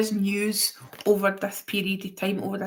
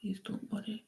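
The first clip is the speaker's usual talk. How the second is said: whisper; 15 dB quieter than usual. Real, not acted. Reassures viewers softly.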